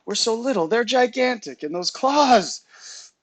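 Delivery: high, loud, worried cub; alarmed protest. A narrator's reading. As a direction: pitch it up, stretching the last words. Frightened cub protests in story.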